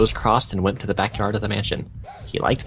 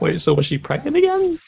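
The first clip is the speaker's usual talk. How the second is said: high disbelieving question; shocked; 3 dB louder than usual. Real, not acted. Reader stunned by the fanfic's implication, high disbelief. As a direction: gasp at the plot twist.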